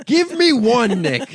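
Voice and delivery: very loud, very high shout; exasperated full-out shouted demand. Real, not acted. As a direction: yell it as a challenge, voice soaring. Repeats the demand, escalating.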